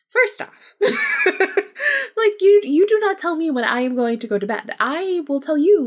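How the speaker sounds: high, loud laughing indignation; indignant laughing. Real, not acted. Rejecting a spirit's bedtime order, high laughing outrage.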